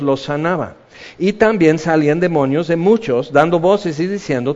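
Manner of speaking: ordinary delivery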